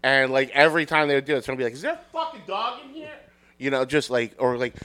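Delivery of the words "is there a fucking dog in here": high, hushed, quick mock complaint; imitated onlooker. Someone in the crowd complaining about barking, high and hushed. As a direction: squeak it quickly under your breath. Imitating an irritated audience member.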